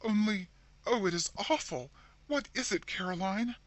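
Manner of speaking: high, shaking horrified plea; horror-stricken. A narrator's reading. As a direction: plead in horror. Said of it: "whose horror-stricken tone matched her sister's".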